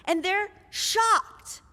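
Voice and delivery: ordinary talk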